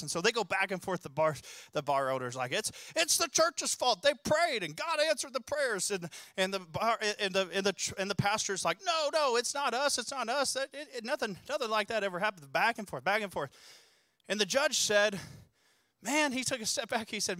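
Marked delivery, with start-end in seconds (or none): 2.5-5.9 indignant bar owner. Retold or imitated. Bar owner quarrels in court. 8.8-12.5 protesting pastor. Retold or imitated. Pastor denies in court.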